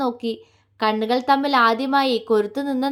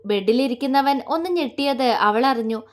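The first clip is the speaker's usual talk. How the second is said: ordinary delivery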